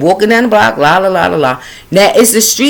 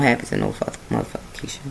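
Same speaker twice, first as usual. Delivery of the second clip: trailing whisper; 14 dB quieter, faster than usual. Real, not acted. Trails into muttered aside.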